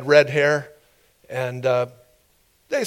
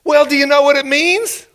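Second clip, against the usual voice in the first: high, loud pressing question; challenging official; 11 semitones higher, 5 dB louder than usual. Retold or imitated. Officials to the man who cannot read Chinese, mock challenge. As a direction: pitch it up and press him for an answer.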